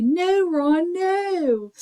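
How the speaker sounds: high, drawn-out mock wail; hammed-up high cry, stretching each NO; niece's movie cry. Recalling niece's line from the movie, high dramatic wail. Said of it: "I watch that movie and I hear".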